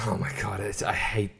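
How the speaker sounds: breathy whisper of disbelief; whispered dismay. Real, not acted. Whispering dismay about the new episode. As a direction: hush it like you can't stand it.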